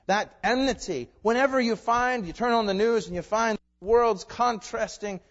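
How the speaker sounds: loud, emphatic sermon delivery; forceful preaching. Real, not acted. Preacher decries the world hating God's word, loud and emphatic. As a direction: hammer each phrase at the congregation.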